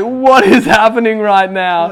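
high, loud, slow alarmed cry; panicked and half laughing, loud and high; retelling sudden vomiting, loud and high with alarm. Retold or imitated. Retold panic while vomiting.

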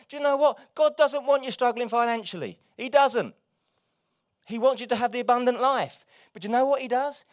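loud, high, driving preacher's cry; forceful preaching. Acted. Preacher urging the church about money, loud and forceful. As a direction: preach it hard to the congregation.

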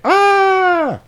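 loud high imitation scream; mock scream. Retelling the mother finding her bloody daughter, mock scream. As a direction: shriek it as the horrified mother.

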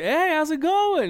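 high, loud, drawn-out hello; hammed-up retold greeting; mock cheery greeting. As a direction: stretch an over-friendly hello up high. Acts out his awkward greeting.

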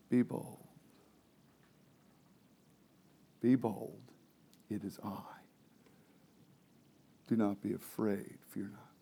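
quiet, gentle words of comfort; calm the frightened disciples softly; soft reassurance. Acted. Teacher voices Christ calming the terrified disciples.

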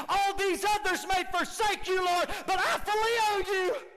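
high, shouted boast; loud vow. Acted. Peter vowing loudly to Jesus. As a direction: declare loyalty loudly.

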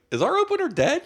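high shocked question; mock shock. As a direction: act stunned and ask it high. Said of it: "and I'm like".

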